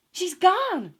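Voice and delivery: loud, high amazed cry; Dorothy crying out as the witch melts. Acted. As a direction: call out in disbelief. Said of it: "cried Dorothy".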